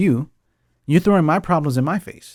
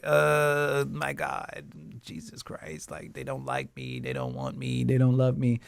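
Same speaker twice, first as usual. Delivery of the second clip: mock despair; 11 dB quieter than usual. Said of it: "and you're like".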